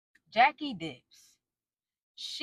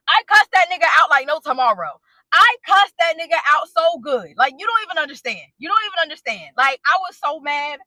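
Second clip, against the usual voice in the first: emphatic, much louder outburst; loud, worked up; 4 semitones higher, 9 dB louder than usual. Real, not acted. Wife telling how she cussed husband out, loud and worked up. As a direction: belt it out to drive the point home. Reliving her anger at husband.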